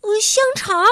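muddled lady pops out her word, high and bright with relief; a silly lady's high blurt; sudden recall. Acted. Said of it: "糊涂小姐终于想起来了".

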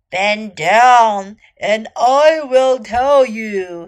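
slow, drawn-out crocodile invitation; luring crocodile. A narrator's reading. Crocodile coaxes little elephant to bend down, slow luring. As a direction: stretch the words to lure your prey closer.

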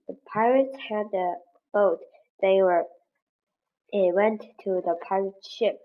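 ordinary talk

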